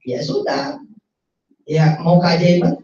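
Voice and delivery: ordinary delivery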